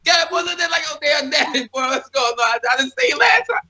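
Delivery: squeaky high imitation of himself greeting his family; mock high voice. Telling podcast hosts about his southern cousins, high mocking voice. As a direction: pitch it way up, goofy and playful. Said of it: "I said".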